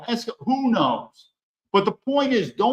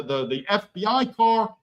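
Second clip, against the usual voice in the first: usual speaking voice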